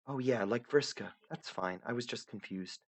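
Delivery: lightly voiced young heroine, gently insistent; Aradia, softly puzzled. Aradia lost in the void, pleading softly to the narrator.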